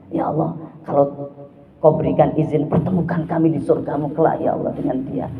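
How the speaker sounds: low, hushed, near-whispered prayer; silent heart prayer. Retold or imitated. Believer praying silently for a woman, hushed and low. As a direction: pray softly inside your heart.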